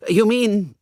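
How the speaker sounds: lightly voiced young toff, urgent and conspiratorial; eager young Hugo.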